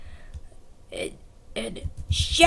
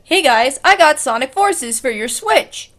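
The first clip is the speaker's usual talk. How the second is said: louder cheerful Sonic; 8 dB louder than usual. Acted. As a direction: announce the game proudly. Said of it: "And Sonic's like".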